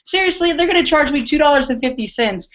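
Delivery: high-pitched imitation of a friend's gripe, easing down; put-on indignant whine; mock complaining. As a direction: imitate his petty grumble, starting high. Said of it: "he was complaining about that".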